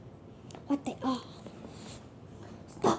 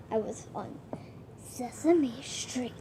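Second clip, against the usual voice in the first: hushed confession; 4 semitones lower, 12 dB quieter than usual. Acted. Confiding a past job on camera.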